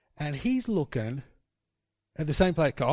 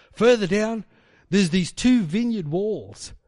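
ordinary talk, nothing marked

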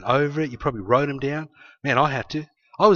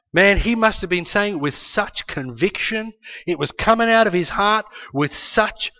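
normal talking voice

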